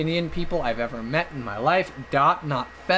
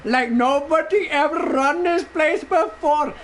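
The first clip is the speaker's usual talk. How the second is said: high, animated mock Indian accent; Indian clerk impression; 8 semitones higher than usual. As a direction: proudly announce prices in a put-on Indian accent. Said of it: "doing this impression".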